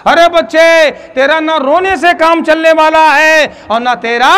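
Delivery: high, loud call. Acted. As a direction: raise the voice to grab him. Holy man calls out to him.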